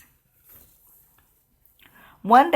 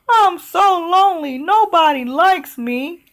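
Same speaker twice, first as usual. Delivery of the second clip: tearful dragon; 8 semitones higher, 4 dB louder than usual. Acted. Dragon tells Elizabeth nobody likes him, higher tearful whine. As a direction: whimper it like a lonely dragon.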